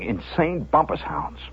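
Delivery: ordinary delivery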